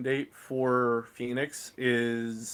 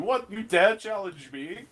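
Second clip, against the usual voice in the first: a raised, mocking AI challenge; booming echoing villain monologue; 8 semitones higher than usual. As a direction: sneer it like an offended ship AI. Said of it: "you hear Phoenix, like".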